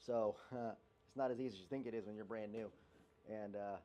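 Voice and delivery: normal talking voice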